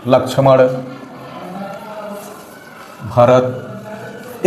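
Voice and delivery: Lakshman and Bharat set apart by long pauses; pausing at commas. Shown as a demonstration. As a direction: take a breath break between Lakshman and Bharat. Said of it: "इस प्रकार से".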